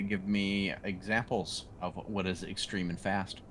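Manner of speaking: usual speaking voice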